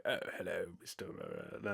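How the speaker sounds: low, quiet villain purr; film bad guy. Parodying British film villains in a low purr. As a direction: greet him like a smug British movie villain. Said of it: "bad guys in films who are like".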